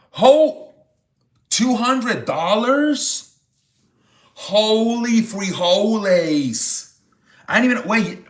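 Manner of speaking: high, slowed gasping disbelief; can't believe the donation amount; stunned surprise. Real, not acted. Streamer reacting to a two hundred dollar donation, stunned.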